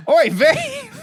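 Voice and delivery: high, loud Yiddish-style exclamation. Retold or imitated.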